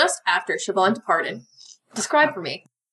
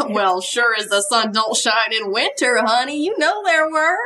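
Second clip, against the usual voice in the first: folksy Southern honey talk; small-town Southern diner accent. Acted. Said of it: "I don't know why Judy has this accent".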